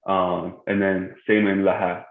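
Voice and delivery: ordinary talk, nothing marked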